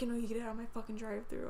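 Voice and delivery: ordinary delivery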